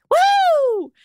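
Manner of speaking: high, loud whoop; excited cheer. Acted. Celebrating the first all-crew meeting, loud high whoop.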